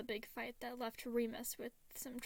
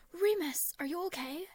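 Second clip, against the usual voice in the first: worried call; 7 semitones higher, 7 dB louder than usual. A narrator's reading. Brother trips and bleeds.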